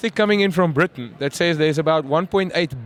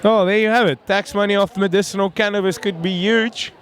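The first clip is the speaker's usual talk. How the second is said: normal talking voice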